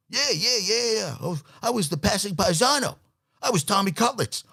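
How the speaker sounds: high, put-on eager has-been voice; washed-up quarterback boasting. Has-been tells tailgater of his glory days, eager. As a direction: boast as a forgotten local hero.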